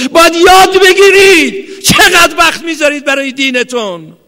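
high, loud impassioned sermon shouting; fiery preaching. Real, not acted. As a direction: pound the words out to the crowd. Preaching at full force. (موعظهٔ پرشور با فریاد)

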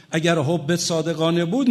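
usual speaking voice

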